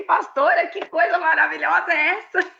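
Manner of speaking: high, marveling exclamation; admiring the penthouse aloud; mock amazement. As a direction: gush over the flat. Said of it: "eu falava assim".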